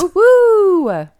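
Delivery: high, loud mock cheer; woo-hoo cheer. Real, not acted. Cohost cheering at the unedited episode, high excited whoop. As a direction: whoop it up playfully.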